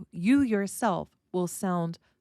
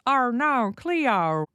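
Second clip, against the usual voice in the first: loud, higher mock Australian accent; 5 semitones higher, 5 dB louder, much slower than usual. Teacher mimicking Australians like a joke, loud Australian accent. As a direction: make fun of Australians with a broad Australian accent. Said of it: "especially Australians".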